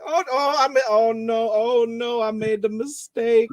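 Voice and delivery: high, worried Southern whimper; panicked Dotson voice. Pretend. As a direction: fret like a pug who made a mistake. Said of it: "we use the Dotson voice for everything".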